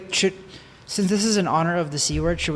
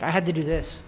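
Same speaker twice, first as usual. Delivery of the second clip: ordinary talk, nothing marked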